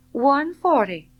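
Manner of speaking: high, loud decisive call; firm bid. A narrator's reading. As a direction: slap the bid down. Scarlett bids decisively.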